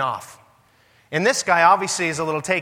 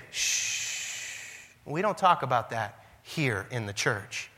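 long soft shush, then slow, hushed words; acted low, slow, very soft reproof; mock hushing; 5 semitones lower, 9 dB quieter, much slower than usual. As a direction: hush it like a forbidden subject. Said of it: "we say".

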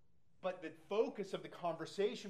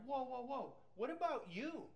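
retold angry; 8 semitones higher than usual. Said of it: "I was so angry".

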